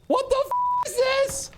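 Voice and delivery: high-pitched stunned disbelief; shocked shriek. Real, not acted. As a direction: squeal it out in total shock. Huge surprise donation appears.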